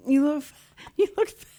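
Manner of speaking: high, laughing through the blunt words. Retold or imitated. Quoting the deaf friends' blunt verdict, high and laughing. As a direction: crack up mid-phrase. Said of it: "And they went".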